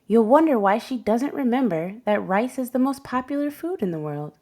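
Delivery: usual speaking voice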